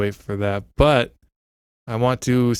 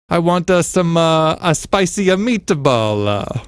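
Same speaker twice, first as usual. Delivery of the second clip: Italian accent; 6 semitones higher, slower than usual. Shown as a demonstration. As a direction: ham up a mock Italian speaker. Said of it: "Here's what I mean".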